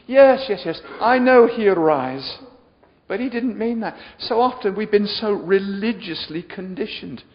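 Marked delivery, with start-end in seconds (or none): 0.1-2.4 loud, high, insistent yeses; impatient acted reply. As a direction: cut in as if you already know. Acting the sister's impatient reply.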